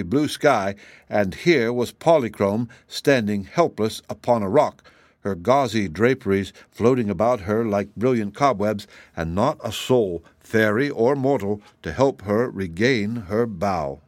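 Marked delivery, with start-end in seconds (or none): none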